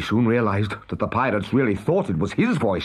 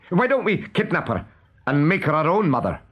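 pirate voice; 7 semitones higher than usual. A narrator's reading. Smee speaking.

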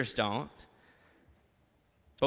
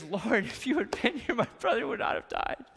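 high, pleading voice acting Mary's grief; Mary's grieving plea; 7 semitones higher than usual. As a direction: plead through tears as Mary. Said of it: "What happens is Mary says".

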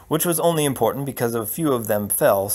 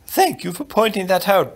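high-pitched, patient queen; queen's voice; 7 semitones higher, 3 dB louder than usual. Acted. Queen to her muddled husband, high-voiced patience. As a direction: thank him in a higher, queenly voice.

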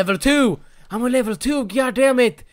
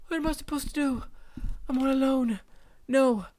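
softer, mock lost and lonely; mock forlorn murmur; 11 dB quieter, faster than usual. Gamer pretending to be abandoned, a soft mock forlorn voice. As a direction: play it small and pitiful. Quiet mock despair after shouting.

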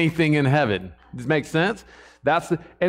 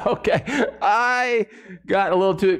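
laughing at his own confession; laughing while admitting fault; 5 semitones higher than usual. Real, not acted. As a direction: chuckle through the words.

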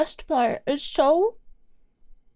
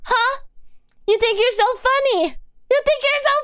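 much higher mock taunt; mock challenge; 9 semitones higher than usual. Streamer teasing chat for acting like comedians, rising mock taunt. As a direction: tease them, pitch climbing.

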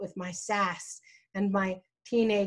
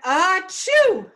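loud, high mock achoo; imitated sneeze; 10 semitones higher, 9 dB louder than usual. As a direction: act out a big sneeze mid-sentence. Acts out the sneeze in telling.

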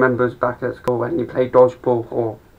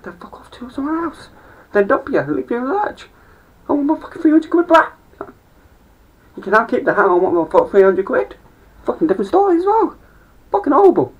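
furious pitched-up rant about wanting the money back; high-pitched outraged rant; 12 semitones higher than usual. Real, not acted. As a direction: squeal your outrage up in a high voice. Ranting about exes keeping hats.

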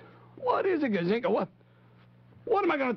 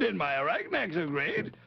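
normal talking voice

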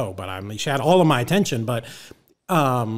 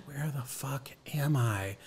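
hushed, slow disbelief; stunned aside; 10 dB quieter, slower than usual. Retold or imitated. Newcomer to himself at a strange meeting, hushed bewilderment. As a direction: mutter it slowly to yourself. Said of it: "And I'm like".

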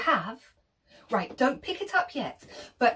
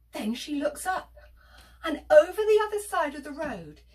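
ordinary delivery, nothing marked

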